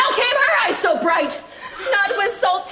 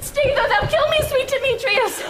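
desperate plea; 6 semitones higher than usual. Acted. Helena chasing Demetrius.

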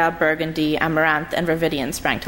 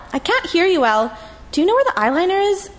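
younger sister calling out; 12 semitones higher, faster than usual. Acted. Lee shouts from down the hall.

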